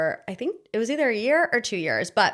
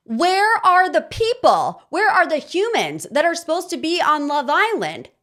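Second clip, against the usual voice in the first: exasperated rant; 6 semitones higher, 3 dB louder than usual. Real, not acted. Vlogger ranting at an empty villa, exasperated and high. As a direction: demand an answer in disbelief.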